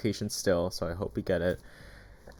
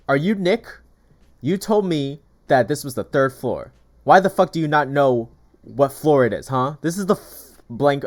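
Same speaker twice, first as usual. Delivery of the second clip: loud, higher furious tenant yelling; retold angry yell; 4 semitones higher, 8 dB louder than usual. Angry tenant yelling at the broker's rep, retold loudly.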